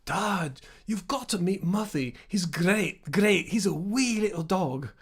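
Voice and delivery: put-on a light Edinburgh Scottish accent for a kid; Edinburgh Scottish kid's voice, "wee" stretched slowly. Retelling the Scottish kids praising the dog in an Edinburgh accent. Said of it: "my Edinburgh accent".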